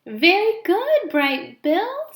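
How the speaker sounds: high, loud, drawn-out praise; praising old goose. Acted. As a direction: cheer the gosling on like a proud old goose. Loudwing praises gosling.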